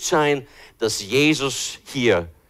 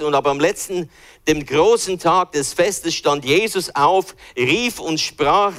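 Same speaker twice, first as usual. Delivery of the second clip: solemn reading; 4 semitones higher than usual. Acted.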